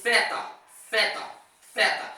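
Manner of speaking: theta with air blown between the teeth; tongue between teeth. Shown as a demonstration. Teacher drilling theta with the tongue between the teeth. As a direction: breathe the th of theta through the teeth. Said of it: "tipo soprano entre os dentes".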